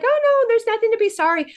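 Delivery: high and quick reassurance; bright reassurance. Retold or imitated. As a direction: brush off her apology brightly. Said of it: "And I'm like".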